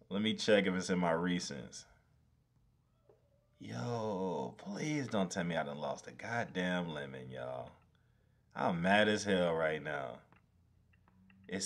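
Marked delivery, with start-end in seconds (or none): none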